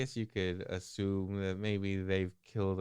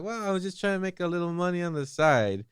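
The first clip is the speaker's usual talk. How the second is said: high, quick, louder excuse; defensive protest; 11 semitones higher, 5 dB louder, faster than usual. Acted. Teddy excusing himself when accused of using him, high hurried protest. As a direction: rush the excuse out in a raised voice.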